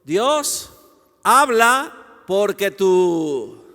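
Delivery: slow, loud, drawn-out appeal; ringing prayerful summons; calling out to God. Acted. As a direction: cry out in prayer, stretching the words. Models crying out to God.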